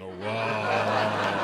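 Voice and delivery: low, dazed stoner "oh wow"; mock a stoner staring through binoculars; stoned awe. Stoner marvelling at binoculars, low dazed awe. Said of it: "the binoculars going".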